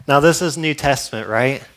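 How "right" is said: usual speaking voice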